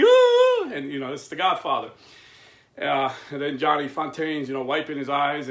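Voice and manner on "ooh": sharp, very high, loud mock "ooh"; a gleeful squeal at the burn. Reacting to Godfather's slap, high loud ooh.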